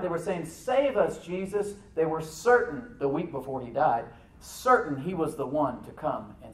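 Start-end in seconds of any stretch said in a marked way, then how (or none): 0.5-1.7 high, raised cry for help. Retold or imitated. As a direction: call out urgently at a high pitch.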